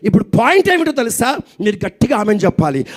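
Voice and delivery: usual speaking voice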